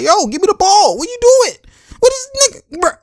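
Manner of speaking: very high, loud exasperated yell; KD demanding the ball. Retold or imitated. Angry KD calling to Draymond for the ball, exasperated high yell. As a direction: yell in high-pitched disbelief at a teammate.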